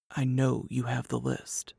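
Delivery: soft, low-voiced statement; quiet, restrained, confidential tone. A narrator's reading. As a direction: keep it low and hushed. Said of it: "His voice was low".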